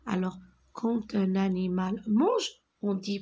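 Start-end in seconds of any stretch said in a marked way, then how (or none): none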